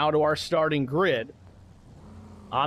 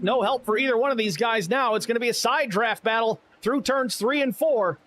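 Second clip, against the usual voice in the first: raised, urgent play-by-play; race caller; 7 semitones higher than usual. Acted. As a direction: call the pass like a track announcer. S0 calls the battle live.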